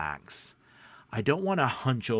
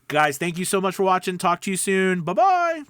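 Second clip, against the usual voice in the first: cheerful sign-off; 9 semitones higher, 6 dB louder than usual. Real, not acted.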